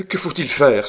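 loud, high desperate outcry; violent cry. A narrator's reading. Roland crying out to Marguerite, loud and desperate. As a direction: fling the question out with violence. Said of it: "s'écria Roland avec violence".

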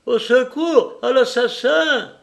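high, loud cry for help; terrified yell. A narrator's reading. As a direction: scream for rescue from a killer, drawing out the end. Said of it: "hurla-t-il".

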